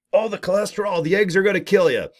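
lightly voiced fussy critic; mock nagging warning. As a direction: parody the worried naggers.

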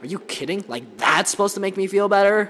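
loud, high disbelieving yell; incredulous outburst. Real, not acted. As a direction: shout it out in disbelief.

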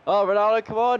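high, loud urging; will the striker on to score; urgent pleading. Real, not acted. Gamer urging Ronaldo on, excited and high.

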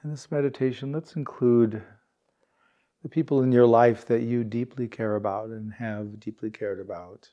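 calm, unhurried meditation guide, words spaced by long pauses; guided meditation. Real, not acted. Meditation teacher guiding warm-hearted peers to relax, calm and unhurried. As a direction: gently lead a sitting group into stillness, leaving space.

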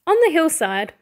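ordinary delivery

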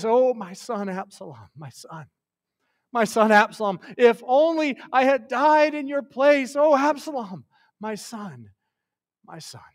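acted lament, swelling high then sinking to a hush; tearful grieving father. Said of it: "hear the tears in his voice".

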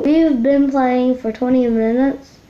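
normal talking voice

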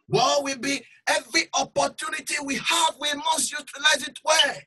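loud, high preaching cry; forceful preaching. Acted. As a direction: drive the warning home.

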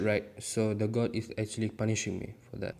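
ordinary talk

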